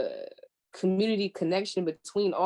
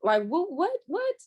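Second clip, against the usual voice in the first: rising, baffled what what; mock baffled; 5 semitones higher than usual. Reacting to a dumb post, high baffled mock disbelief. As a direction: play dumbfounded at a stupid post. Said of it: "and be like".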